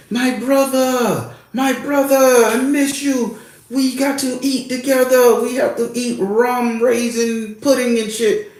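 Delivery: high, drawn-out singsong mimicry of a warm welcome; pleasant effusive greeting. Spirit greeting a returning visitor, pleasant high-pitched imitation. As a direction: imitate an overjoyed host, stretching words up high. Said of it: "he's greeting me pleasantly".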